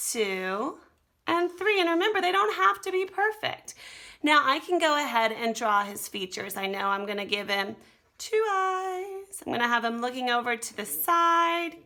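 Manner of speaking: ordinary talk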